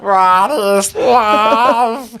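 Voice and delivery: high, very slow dying robot. Pretend. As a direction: wind down like a broken robot. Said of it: "You sound like a robot just about to die".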